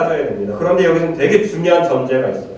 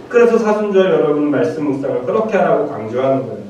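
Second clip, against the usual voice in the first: ordinary delivery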